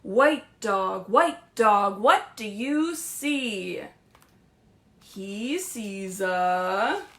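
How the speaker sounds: slow, singsong picture book read; lilting read-aloud to little kids; reading to children. Acted. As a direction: read it like story time.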